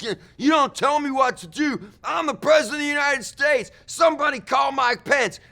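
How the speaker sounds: high, loud, fast furious ranting; furious mock rant. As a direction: bellow it like a tantrum-throwing president. Trump rages at staff.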